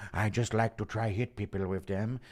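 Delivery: lightly imitated fighter voice; a hint of the heavyweight's voice; Francis Ngannou. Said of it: "Impression of Ngannou and McConaughey".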